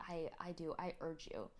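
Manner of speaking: ordinary talk, nothing marked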